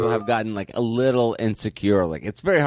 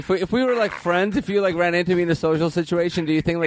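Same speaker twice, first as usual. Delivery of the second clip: needy service rep; 7 semitones higher than usual. Acted. Plays needy service rep on phone.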